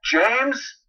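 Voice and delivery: high worried call across the yard; anxious father calling his son. A narrator's reading. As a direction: call for a missing child. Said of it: "so I called out to him".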